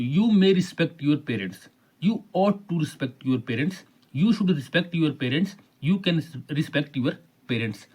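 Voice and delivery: each option read out slowly and evenly. Shown as a demonstration. Teacher reads the answer options to students, slowly. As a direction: read the choices slowly for the class. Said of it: "find the correct grammatical statement".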